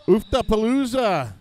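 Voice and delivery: loud, high announcer's whoop; playful high-pitched shout; hollered event name. Pretend. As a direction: belt out the silly name like a hype man.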